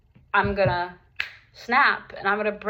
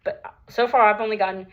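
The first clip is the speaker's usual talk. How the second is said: normal talking voice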